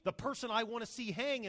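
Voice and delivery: exasperated Haman. Acted. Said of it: "he says to his wife".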